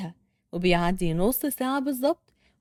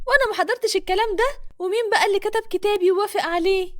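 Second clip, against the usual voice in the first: indignant woman; 8 semitones higher, 4 dB louder than usual. Acted. Hana protests the marriage.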